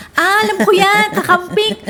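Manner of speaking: loud, high-pitched imitation of a mom; comically eager mock-mom voice; mom's confident exclamation. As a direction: blurt it out proudly, high and loud, as a know-it-all mom. Imitating mom's mistaken recognition.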